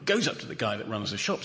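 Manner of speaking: ordinary talk, nothing marked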